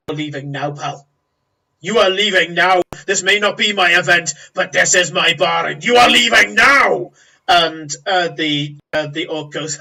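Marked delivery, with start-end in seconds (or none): 1.8-7.1 loud, forceful order building to a shout; raised voice, hammering each phrase, peaking in a shout; angry barkeep outburst. Acted. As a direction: throw a troublemaker out of your own bar. Baron escalates, ordering orc out.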